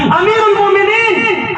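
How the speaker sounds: high, carrying call. Retold or imitated. Slave keeps calling out for the caliph. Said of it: "चार पांच आवाजें ज्यादा लगा दी".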